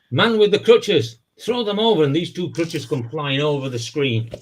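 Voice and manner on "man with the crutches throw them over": preacher booming a command to the crowd. Retold or imitated. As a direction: call out over the tent like a faith healer. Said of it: "And he says".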